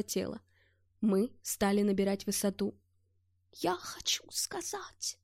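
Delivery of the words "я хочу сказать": weak strained whisper. Acted. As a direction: force the words out faintly. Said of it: "повторил Гельмут". (слабый шёпот)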